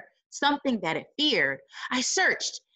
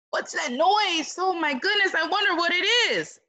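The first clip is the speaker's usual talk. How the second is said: higher, gasping what's that noise; excited wonder; 7 semitones higher than usual. Acted. Reader reacting to the story noise, higher and excited. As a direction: gasp and wonder aloud to the kids.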